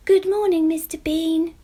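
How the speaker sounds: high, loud cheerful call; shouted greeting. Acted. Jemima shouts hello to Mister Bean, high and loud. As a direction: call out across the garden. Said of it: "shouted Jemima".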